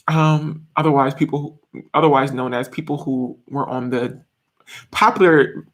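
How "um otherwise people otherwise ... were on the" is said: ordinary delivery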